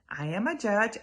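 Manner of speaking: ordinary delivery, nothing marked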